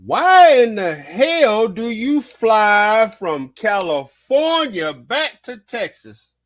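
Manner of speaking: loud, slow, stretched-out disbelief; incredulous rant. Real, not acted. Vlogger ranting at the wife's choice, loud slow disbelief. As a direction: drag it out like you can't believe it.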